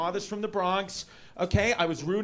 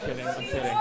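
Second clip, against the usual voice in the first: breathy whisper, backing off; whispered take-back; 12 semitones higher, 3 dB quieter, faster than usual. Real, not acted. Whispered to the crowd after the joke, backing off. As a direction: hush it as if taking it back. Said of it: "that was just a joke".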